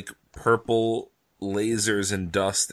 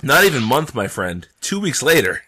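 loud, amused correction, pitch jumping up; emphatic correction; 2 semitones higher, 8 dB louder than usual. Real, not acted. Correcting his co-host loudly and gleefully, emphatic. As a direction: cut in loudly to set the record straight.

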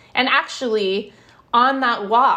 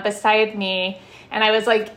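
usual speaking voice

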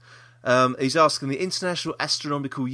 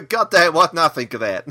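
higher, louder mock Southern accent; Deep South accent; 5 semitones higher, 8 dB louder than usual. Host imagines the singer kicking himself, mock Deep South accent. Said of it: "I don't know why I did that accent".

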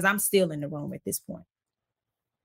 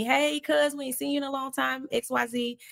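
bright raised greeting voice, a bit fast; mock happy greeting; 7 semitones higher than usual. Family greeting her warmly at the hotel, cheerful voice. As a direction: greet a long-lost cousin cheerfully. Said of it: "They happy to see me".

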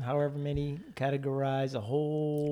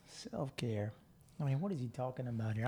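puzzled muttering; 8 dB quieter than usual. Retold or imitated. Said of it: "originally I was like".

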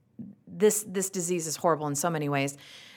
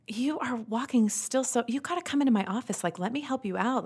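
concerned neurologist; 7 semitones higher than usual. Retold or imitated. Imitating concerned neurologist offering help.

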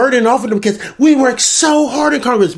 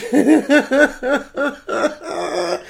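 high, long giggling fit; real helpless laughter; 6 semitones higher, 4 dB quieter than usual. Real, not acted. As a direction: keep laughing until out of air. Cracks up at own bit.

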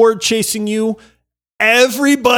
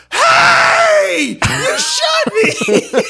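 put-on indignant kid; much slower than usual. Imitating Ryan's young son yelling after being shot. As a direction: squeal it like an outraged seven-year-old. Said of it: "And then he just goes".

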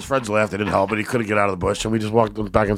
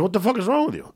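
retold angry challenge; 10 semitones higher than usual. Confronting the heckler.

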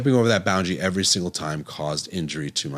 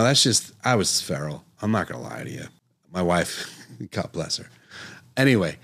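ordinary talk, nothing marked

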